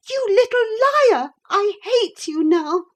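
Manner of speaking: high, loud breathless rage; high girl screaming in fury; panting with passion. A narrator's reading. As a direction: spit it out red in the face. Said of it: "she panted, face red with passion".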